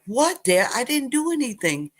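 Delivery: a touch of a teary protest; pleading child. Retold or imitated. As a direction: beg Dad like a kid who fears a scolding. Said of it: "And I'm like".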